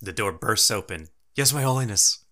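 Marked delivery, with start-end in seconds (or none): 1.3-2.2 eager servant. Acted. Servant answers the summons.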